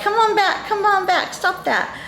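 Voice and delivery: high-pitched calling back; playful high self-scolding; mock self-summoning. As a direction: call your wandering mind back, pitched high. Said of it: "I have to go like".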